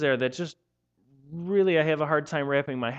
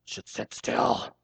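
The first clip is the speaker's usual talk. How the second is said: disapproving bigwigs; 7 semitones lower, slower than usual. Retold or imitated. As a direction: sniff like a stuffy official. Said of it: "they're like".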